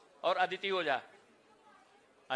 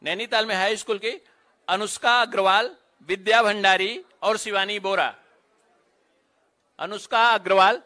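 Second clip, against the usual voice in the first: measured announcer voice, each name set apart; roll-call announcer; 5 dB louder than usual. Real, not acted. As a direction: call out names at a ceremony.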